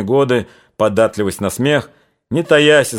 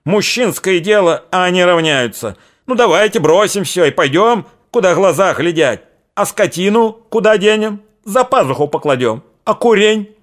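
furious ranting; 7 semitones higher than usual. A narrator's reading. Old man rages at the women over leaving the farm, furious shouting. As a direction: scold them in a rage. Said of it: "иступлённо заорал".

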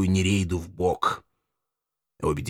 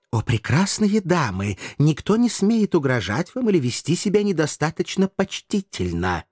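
higher-pitched courtly flattery; theatrical gallantry with swooping pitch; 8 semitones higher than usual. A narrator's reading. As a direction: flourish it like a gallant gentleman. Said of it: "галантно поклонился Эшли".

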